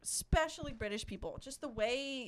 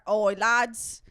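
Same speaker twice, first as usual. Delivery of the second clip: louder put-on British lad accent; mock British kid; 6 dB louder, slower than usual. Joking as a British kid telling his mates, mock British accent. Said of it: "you're a British kid".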